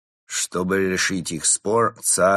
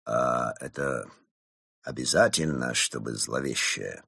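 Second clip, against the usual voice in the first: low, soft, hesitant question; timid Mole; 7 semitones lower, 4 dB quieter than usual. A narrator's reading. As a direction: ask meekly in a low, halting voice. Timid Mole questions the ominous spot. (робко, тихо и низко)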